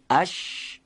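اش held on a long voiceless sh; drawn-out sh. Shown as a demonstration. Teacher contrasting shin with jim, long hissing sh. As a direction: stretch the end of اش into a steady hush. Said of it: "شد شین".

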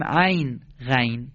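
عین said æyn, غین said ghæyn; Arabic ayn and ghayn. Shown as a demonstration. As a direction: open the vowel the Arabic way in عین. (تلفظ عربی عین و غین با æ)

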